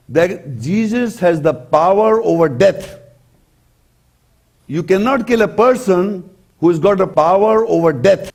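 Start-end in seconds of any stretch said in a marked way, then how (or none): none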